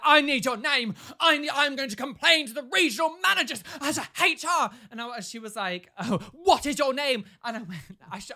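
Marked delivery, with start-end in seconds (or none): 0.0-7.2 high, loud, hammed-up demand; bossy mock threat; mock demanding woman. Angry customer demanding a name, hammed-up.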